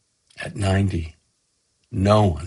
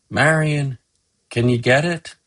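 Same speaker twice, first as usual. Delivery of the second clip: mild call, pitched higher; calling to his wife; 6 semitones higher than usual. Acted. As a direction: call lightly to someone in the next room. Husband calls to his wife.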